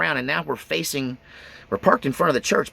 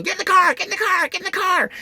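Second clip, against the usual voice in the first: yelling aunt; faster than usual. Retold or imitated. Aunt yelling at her son to jump in the getaway car. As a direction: scream it at the boy to hurry. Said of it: "starts yelling".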